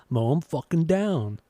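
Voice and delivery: mock singer's accent on "Mow 'em fucking down"; imitated singer's pronunciation. Fan quoting a [name] lyric, imitating the singer's silly pronunciation. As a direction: say it dumbly, copying the singer. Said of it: "it's so dumb the way you say it".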